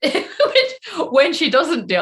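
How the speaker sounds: high, loud, laughing through the words. Real, not acted. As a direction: talk through a real laugh. Laughing while teasing about her friend.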